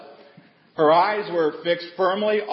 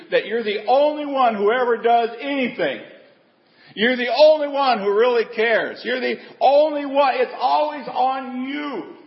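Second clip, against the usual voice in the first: whiny martyr; 7 semitones higher, 3 dB louder than usual. Retold or imitated. Mocking martyr complaint.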